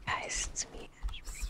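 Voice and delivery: imitating a whispered hello; hushed off-camera mumble. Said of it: "when she's not recording. She's like".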